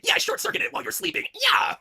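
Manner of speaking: high shrill greedy shrieking; shrieking villain. Acted. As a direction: cackle out your victory in a high voice. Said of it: "that money is all mine".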